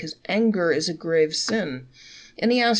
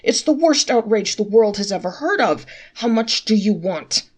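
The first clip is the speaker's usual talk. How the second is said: outraged shout; 2 semitones higher, 7 dB louder than usual. A narrator's reading. Traveler shouting at the innkeeper over the bill, loud. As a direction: bellow it out, scandalized. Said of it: "he cried".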